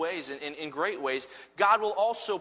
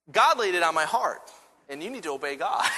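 hammed-up pious scolding, hitting GOD hard; mock preachy rebuke; 3 semitones higher, 4 dB louder than usual. Husband pretending to preach at his wife, mock stern. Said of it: "I didn't say it quite like that".

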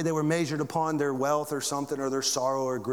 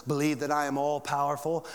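ordinary delivery

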